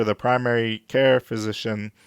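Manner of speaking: usual speaking voice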